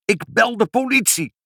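high-pitched angry yell; shouted threat. A narrator's reading. Pinkepank threatening mother with police, high shouted threat. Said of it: "schreeuwde meneer Pinkepank".

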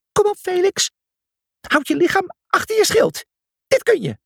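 high cheering call; cheering shout. Acted. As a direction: shout encouragement across the arena. Cheering Felix on in the arena.

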